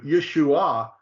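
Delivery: YESHUA stretched as yeh-shoo-AH; slow YESHUA with stress on the last AH; third-syllable accent. Shown as a demonstration. Teacher pointing to the spelling, YESHUA slowly accented on final AH. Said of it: "makes the accent on the third syllable".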